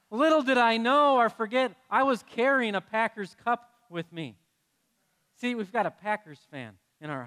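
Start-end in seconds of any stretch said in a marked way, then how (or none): 0.1-4.4 comic reveal. Real, not acted. Punchline of the story.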